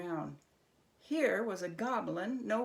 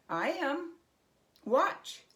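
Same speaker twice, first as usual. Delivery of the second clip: higher, emphatic challenge; confident dare; 7 semitones higher, 5 dB louder, slower than usual. A narrator's reading. Herschel to the goblin, higher and emphatic. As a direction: prove it on the spot.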